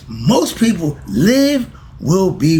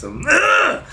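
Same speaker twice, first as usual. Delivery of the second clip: frustrated groan; 12 semitones higher than usual. Real, not acted. Frustrated at running long.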